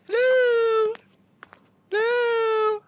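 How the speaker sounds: very high, loud, cracking calls; panicked calling. Real, not acted. Player searching for the missing car, calling in panic. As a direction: call out desperately into the dark.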